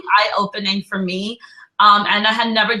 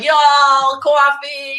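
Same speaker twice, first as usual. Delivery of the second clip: Y'all, coffee in a higher, louder mock Southern twang; Southern accent; 5 semitones higher, 5 dB louder, much slower than usual. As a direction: play up a Southern belle saying coffee. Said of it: "but I'm in the South, so it's all messed up".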